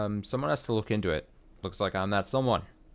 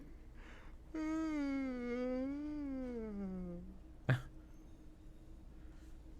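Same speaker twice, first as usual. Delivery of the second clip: helpless high-pitched laughter; long laugh; 13 dB quieter than usual. Real, not acted. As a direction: crack up uncontrollably. Player cracks up at the echo.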